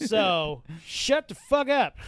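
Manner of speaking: slow, loud, emphatic anger; pissed off. Retold or imitated. Officer shuts down arguing dog owner, pissed off. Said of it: "I just get pissed off".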